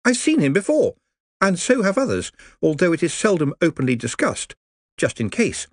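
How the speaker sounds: chatty and upbeat; cheerful. Acted. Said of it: "as cheerfully as possible".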